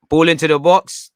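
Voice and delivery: loud call. Real, not acted. Live corner, calling it out.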